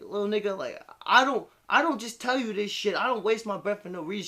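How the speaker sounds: high, loud mock scolding; scolding mom. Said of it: "she like".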